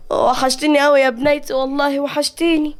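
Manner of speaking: high, emotional mother's voice; acted tearful reunion, pitched high. A narrator's reading. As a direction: pour out longing as if through tears. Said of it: "نادية بدموع".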